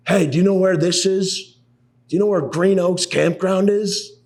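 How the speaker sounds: high, slow, put-on dad voice calling out; dad asking stranger. Dad calling to a stranger on the street, high and slow. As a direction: flag down a stranger, drawn out.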